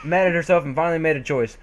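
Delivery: ordinary talk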